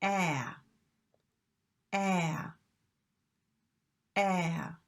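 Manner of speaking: nose wrinkled, overdone flat æ; "Ah" pushed hard as a nasal æ; exaggerated æ. Shown as a demonstration. Teacher exaggerating the vowel so students hear it. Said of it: "I exaggerate and I'll wrinkle my nose".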